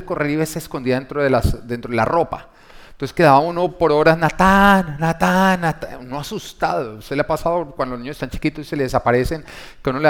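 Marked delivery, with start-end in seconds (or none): 4.2-6.0 put-on loud, high calling of a lost boy's name; calling out for child. Mother searching the mall, calling her son loudly. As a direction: call him across the store, worried.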